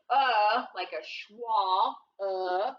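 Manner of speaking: "uh" said short and neutral like a schwa; teacher modelling a schwa; schwa sound. Shown as a demonstration. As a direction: model the lazy "uh" vowel. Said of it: "This time he's saying".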